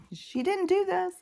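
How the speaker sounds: a playful high voice for the dog; mock innocent dog voice. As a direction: speak for the dog as if it's not her fault.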